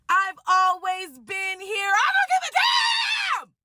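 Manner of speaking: very high, drawn-out mock yelling; mock defiant shout. Bride imitates the rival refusing to back off, defiant yell. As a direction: stretch it out into a squealing, hammed-up shout.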